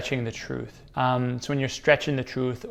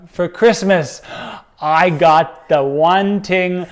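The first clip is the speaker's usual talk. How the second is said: excited; 6 semitones higher, 12 dB louder, much slower than usual. Acted. Example sentence acted out excitedly.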